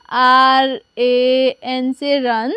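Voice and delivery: very slow, one letter at a time; slow spelling. Shown as a demonstration. Teacher slowly spells a name for pupils. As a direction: stretch out each letter for learners. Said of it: "अब हम इसे English में translate करेंगे".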